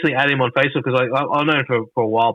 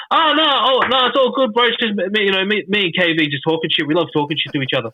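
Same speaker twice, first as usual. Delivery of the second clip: much higher, breezy mock reassurance; imitating Crash; 6 semitones higher than usual. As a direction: toss it off lightly, high and easy, like a chill buddy. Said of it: "this is what he said".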